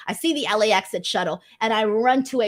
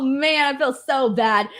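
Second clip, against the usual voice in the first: ordinary talk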